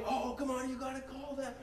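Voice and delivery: high, whiny heckling with a laugh; hammed-up sideline heckler; mock heckler. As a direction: jeer at the ref like an angry parent. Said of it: "Someone said that".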